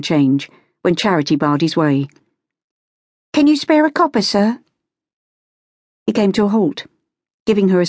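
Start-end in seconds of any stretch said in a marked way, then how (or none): 3.3-4.6 put-on high-pitched young Cockney beggar; high, hopeful street-girl plea; Cockney beggar girl, pleading. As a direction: beg politely in a high London street voice. Street girl begging from gentleman.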